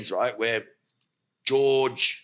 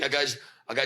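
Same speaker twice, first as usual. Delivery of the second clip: ordinary talk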